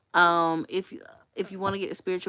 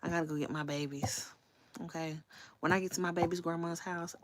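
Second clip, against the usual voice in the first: ordinary delivery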